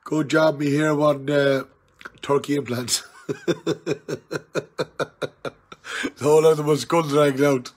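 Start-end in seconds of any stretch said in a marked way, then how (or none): 3.3-5.5 tipsy chuckle; laughter. Real, not acted. Laughing at his turkey implants joke, chuckling. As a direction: laugh at your own joke.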